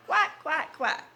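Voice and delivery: quacking. Acted. Duck sound in story.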